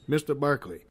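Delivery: usual speaking voice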